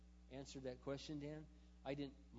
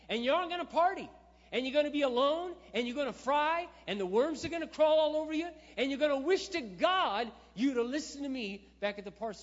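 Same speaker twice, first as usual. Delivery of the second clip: angry shout; 13 semitones higher, 17 dB louder than usual. Retold or imitated. Retold yelling at mocking coworker.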